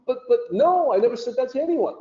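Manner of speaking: high, fast flustered protest; a mild mock panic, hands up; shocked denial. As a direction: blurt out your innocence, caught off guard. Accused friend protests his innocence.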